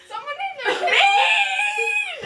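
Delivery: high, slow delighted shout; excited laughing. Real, not acted.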